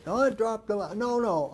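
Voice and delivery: high, loud imitated objection; flustered protest. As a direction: protest hurriedly as the panicked wife. Wife protests as trainer leaves.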